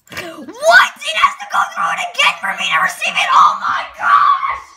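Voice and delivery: high, loud disbelieving yell; exasperated shout. Real, not acted. Game resets the catch.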